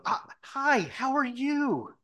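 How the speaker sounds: high, loud, drawn-out hello; over-the-top greeting. Retold or imitated. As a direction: gush it like greeting a long-lost friend. Feigned friendly greeting to suspect.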